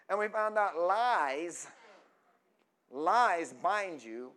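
slow, drawn-out preacher emphasis; loud, deliberate sermon refrain; preaching. Real, not acted. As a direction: stretch each word for the congregation. Preaches a slogan slowly.

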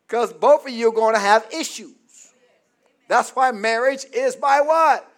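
full-force preaching. Acted. Preacher warning married couples of their issues, full-force preaching. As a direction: preach it out to the whole room.

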